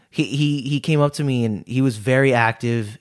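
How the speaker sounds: ordinary talk, nothing marked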